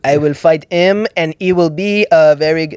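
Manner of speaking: measured words from a polite French Canadian fighter; French Canadian accent. Retold or imitated. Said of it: "George Saint Pierre's French accent".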